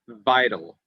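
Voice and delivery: "vital" with the v held and buzzed; emphasised initial V. Shown as a demonstration. Teacher giving a V example, drawn-out initial v. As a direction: lean on the v that opens "vital". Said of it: "Using V at the beginning of a word".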